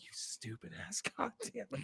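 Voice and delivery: hissed under the breath, half laughing; retold aside to his wife, a whispered jab; mock whisper insult. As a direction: mutter it at her, laughing. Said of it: "And I'm like".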